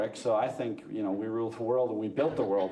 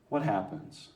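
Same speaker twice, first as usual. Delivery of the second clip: ordinary talk, nothing marked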